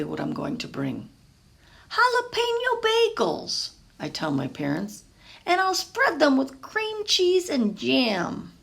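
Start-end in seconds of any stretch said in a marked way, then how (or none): 1.9-3.7 put-on much higher, ringing boy announcement; proud reveal of the answer; triumphant boy. Pablo announcing his choice to his parents, triumphant. 5.5-8.5 put-on higher, slow young boy voice, pleased. Pablo explaining his bagel plan to his parents, pleased and slow.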